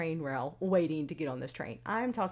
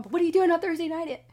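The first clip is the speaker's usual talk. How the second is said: fast, loud, high question; excited tease; 9 semitones higher, 10 dB louder, faster than usual. Retold or imitated. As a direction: burst out with the surprise. Teasing boyfriend with the news.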